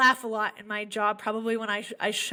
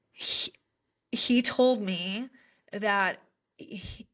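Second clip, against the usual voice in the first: normal talking voice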